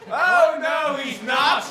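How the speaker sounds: very loud mock-argument shout; full-volume playful contradiction; panto shouted denial. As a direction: bellow a denial back at the audience. Arguing with audience in panto.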